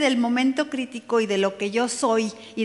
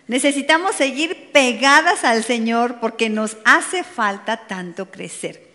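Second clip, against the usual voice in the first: ordinary talk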